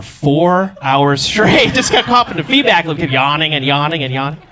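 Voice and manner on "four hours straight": drawn-out punchline. Acted.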